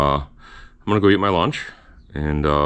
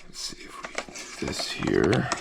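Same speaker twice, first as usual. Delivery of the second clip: whisper; 4 semitones lower, 8 dB quieter than usual. Real, not acted.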